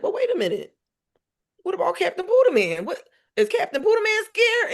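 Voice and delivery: rally him with playful urgency; excited pep talk. Retold or imitated.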